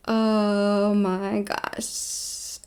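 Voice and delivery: very slow, drawn-out dismay; appalled realization. Retold or imitated. Reacting to a remembered horror, slow and appalled.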